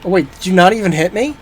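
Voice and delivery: normal talking voice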